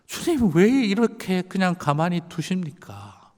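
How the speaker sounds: high, slow reproach to God; anguished questioning. Retold or imitated. Pastor reproaching God for leaving the elder alone, slow and high. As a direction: demand an answer from God. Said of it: "목사같이 기도하고 싶지가 않더라고요".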